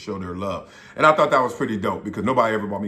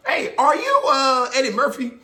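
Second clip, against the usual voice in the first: mock starstruck fan; 12 semitones higher than usual. Mock fan asking wrong name.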